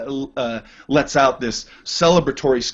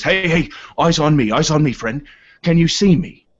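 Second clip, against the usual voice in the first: a lightly voiced sailor, raised and urgent; ship's man rousing a groggy gnome, a touch higher and louder; Orin calling for attention; 6 semitones higher, 3 dB louder than usual. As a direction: snap a dazed man back to focus. GM voices Orin waking player.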